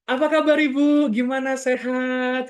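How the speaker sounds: loud, bright-pitched greeting; too loud. Shown as a demonstration. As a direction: boom the greeting much too loud and high. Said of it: "Terus kita ngomongnya kenceng".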